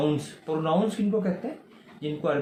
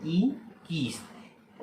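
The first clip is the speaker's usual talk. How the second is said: slow whispered इन कीस्त; whispered answer; much slower than usual. Shown as a demonstration. Teacher answers his own question. (फुसफुसाकर धीरे से जवाब)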